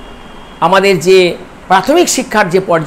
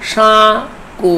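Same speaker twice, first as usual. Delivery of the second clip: জো, রা, সা, কু as four isolated syllables with clear pauses. Shown as a demonstration. Teacher counting four syllables for students, syllable by syllable. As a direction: say জো, রা, সা, কু one at a time. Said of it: "চারটি দল আছে".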